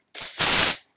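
voiceless hissing snake; a snake hissing at the dragons. Acted. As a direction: hiss through the teeth like a snake. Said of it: "zischt sie".

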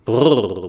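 loud shivering brrr. A narrator's reading. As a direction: shake off the cold with a loud brrr. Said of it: "brummt Mathilda".

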